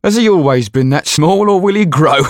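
high, loud mocking servant's voice; laughing servant. Bald servant mocks Tetu's size, high mocking voice. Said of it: "asked the bald-headed man, laughing".

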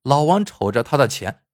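plain reading voice

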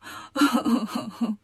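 hammed-up crying sound; mock sobbing. A narrator's reading. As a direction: burst into acted sobs in sympathy. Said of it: "悟空听了也跟着哭". (假装呜呜地哭)

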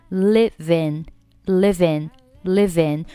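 "live in" merged into "living", slow; linked live in. Shown as a demonstration. Teacher showing how live in links. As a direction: blend "live in" until it sounds like "living". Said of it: "live和in构成辅元连读".

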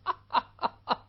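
狗官冷笑. Acted. 狗官被骂后冷笑.

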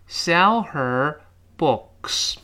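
SELL, HER, BOOKS as separate slow words; slow reading. Shown as a demonstration. Teacher giving the slow example, word by word. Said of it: "这读得很慢".

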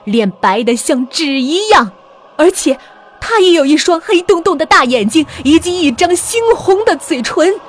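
high, loud, tense storytelling; alarmed horror narration. A narrator's reading. Horror reveal of face at window.

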